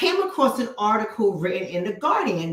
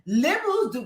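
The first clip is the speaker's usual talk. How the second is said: ordinary delivery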